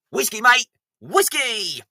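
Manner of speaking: loud happy shout; cheering whiskey. Pretend. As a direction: bellow it like a toast. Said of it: "The traditional Scottish move".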